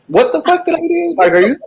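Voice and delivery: high, horrified squeal of disbelief; recoiling at what you just read; shocked disbelief. Retold or imitated. Reader imagining she's the killer, high horrified disbelief.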